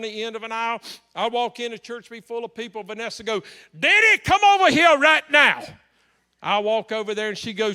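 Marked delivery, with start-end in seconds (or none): 3.8-5.6 loud, high summons; imitating an insistent woman calling out; bossy shout. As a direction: call across a crowded church, hammed-up. Vanessa calling pastor across church.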